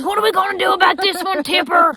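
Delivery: high, loud panicked father; panicked dad. Retold or imitated. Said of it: "up in her face and in panic".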